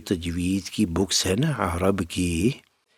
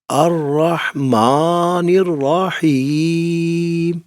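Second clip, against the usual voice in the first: much higher and louder, very slow; off-key loud recitation; 8 semitones higher, 4 dB louder, much slower than usual. Shown as a demonstration. Teacher showing how loud slow reciting goes off-key. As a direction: strain it out loud and drawn. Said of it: "یہ میں بے سرا ہو گیا ہوں".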